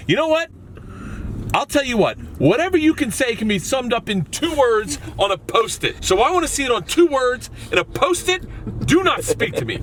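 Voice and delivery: mock angry boss. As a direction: bark the order like an impatient boss. Jay's angry demand quoted.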